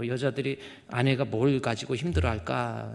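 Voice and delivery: normal talking voice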